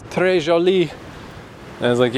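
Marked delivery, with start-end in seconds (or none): 0.0-0.9 a lightly voiced French lady, higher; French phrase. Quoting the restaurant lady, light French lilt. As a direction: say très joli like the lady in French. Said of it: "She told me she'd been".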